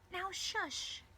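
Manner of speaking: high-pitched motherly hushing; high mother voice, comforting; consoling mother. Acted. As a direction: hush an upset daughter.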